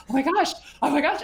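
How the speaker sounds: high, loud oh my gosh; excited client squeal. Retold or imitated. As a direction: squeal in delight. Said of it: "every time she's like".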